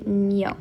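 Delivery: "ño" said slowly as "nyo"; ño ending. Shown as a demonstration. Teacher showing another ending, slow "ño" as nyo. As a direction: linger on the n, glide into "yo" for "ño". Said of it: "depending on the ending".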